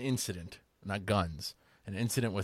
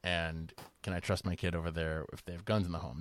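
ordinary delivery

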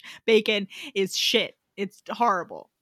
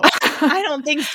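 higher, slower, drawn-out I don't think so; sassy dismissal; 5 semitones higher, 4 dB louder, slower than usual. Real, not acted. Cohost mocking a character's weak comeback, sassy and drawn out. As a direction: sing it out as a put-down.

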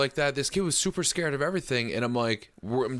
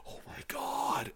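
slow, hushed, breath-only awe; stunned whisper; 13 semitones higher, 10 dB quieter, slower than usual. Retold or imitated. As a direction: whisper it in mock disbelief. Acting the boy's stunned reaction.

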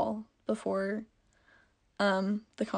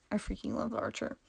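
gushing whisper; 9 dB quieter, faster than usual. Real, not acted.

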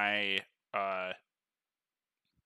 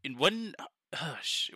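usual speaking voice

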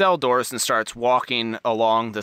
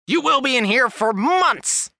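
put-on much higher, shouting old guard; prison guard; 13 semitones higher, 4 dB louder than usual. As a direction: rattle the bars and yell. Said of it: "rattles the bars and goes".

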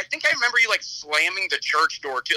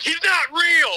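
high, loud mock yell in church; 11 semitones higher, 4 dB louder, slower than usual. Friend yells at wedding guests in church, high mock yell. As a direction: shout it out to the whole church. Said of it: "then you yelled that to everyone".

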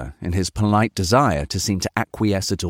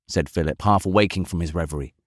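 ordinary delivery

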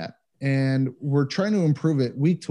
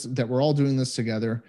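ordinary delivery

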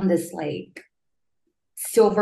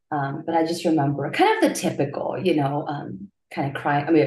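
ordinary talk

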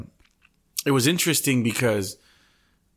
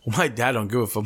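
ordinary talk

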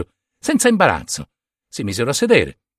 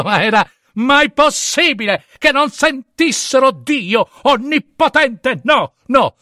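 high, slow, hysterical cry; hysterical disbelief; 9 semitones higher, slower than usual. Acted. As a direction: let it break into mad panic. Narratore sull'orlo della follia. (incredulità isterica, acuta e lenta)

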